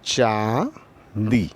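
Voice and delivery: teacher drawing out चांदी for the class; धीरे-धीरे खींचकर; slow and drawn out. Shown as a demonstration. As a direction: stretch चांदी very slowly, syllable by syllable. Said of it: "मान लीजिए चांदी".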